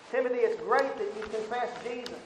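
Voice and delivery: loud, high, pounding sermon delivery; fiery preaching. Acted. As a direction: hammer each phrase home to the congregation. Preaching at full force, building.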